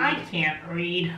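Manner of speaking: slow, flat deadpan. Acted. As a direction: say it dead flat. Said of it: "forget how to read".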